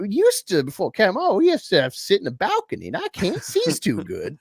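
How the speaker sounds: high, fast exaggerated caricature; mammy voice. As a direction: ham up the old mammy. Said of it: "I said something".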